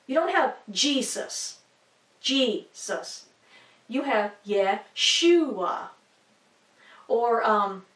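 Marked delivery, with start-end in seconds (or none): 0.7-3.3 Jesus said JEE-sus, front stressed; "Jesus" broken into JEE, then sus; stress on first syllable. Shown as a demonstration. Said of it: "emphasis placed on the beginning of the word". 4.5-5.9 Yeshua said ye-SHU-a, slowly; slow "Yeshua" with the middle stressed; stress on middle syllable. Shown as a demonstration. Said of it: "emphasis placed on the end or middle".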